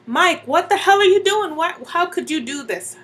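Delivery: loud, high-pitched fury; indignant shouted demand; outraged yelling. Acted. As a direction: yell at the cheating husband in disbelief. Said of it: "I start yelling, screaming".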